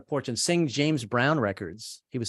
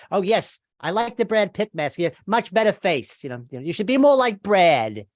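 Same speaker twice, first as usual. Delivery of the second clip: mock wife; 7 semitones higher, 5 dB louder than usual. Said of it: "You know, it's like".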